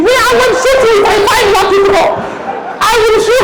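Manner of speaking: very high, scolding mother voice. Acted. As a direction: scold the drunk husband like an angry mum. Mother threatening husband.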